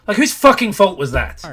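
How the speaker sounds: loud, high rebuke; exasperated shout. Real, not acted. Critic blaming the player for getting caught, exasperated shout. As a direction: throw the blame back at full volume.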